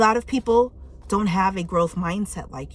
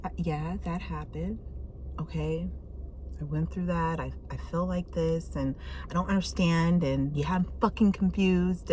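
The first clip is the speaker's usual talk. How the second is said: inner monologue; 2 semitones lower, 7 dB quieter than usual. Retold or imitated. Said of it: "When you finally just like".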